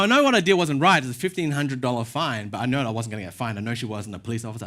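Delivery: ordinary delivery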